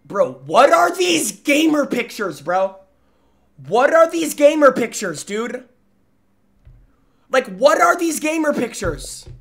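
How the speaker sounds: high, loud disbelief; incredulous outburst. Real, not acted. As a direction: exclaim it appalled, pitch jumping up. Streamer aghast at gamer pictures.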